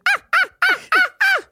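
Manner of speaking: tiny squeaked double-time moans; chipmunk moans. Retold or imitated. Storyteller imitating fast-forwarded sex scene, very high chipmunk moans. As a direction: squeal mock moans like a sped-up tape. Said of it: "double time with a chipmunk sound".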